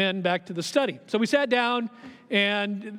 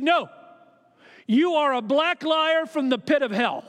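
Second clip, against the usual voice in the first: retold rage, higher and louder but held back; angry accusation; 5 semitones higher, 3 dB louder than usual. Doctor cursing the pastor as a liar, mild mock fury. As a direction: build the insult up with mild fury. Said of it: "stood up with great anger".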